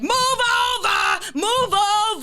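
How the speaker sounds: long, high drawn-out holler; road-rage yell. Acted. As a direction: holler slowly at the car ahead. Acts yelling behind slow drivers.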